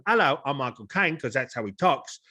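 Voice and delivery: Cockney actor impression; mimicked British film star, punchy London vowels; Michael Caine. Shown as a demonstration. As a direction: slip into a put-on London accent. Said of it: "I'll be watching Michael Caine".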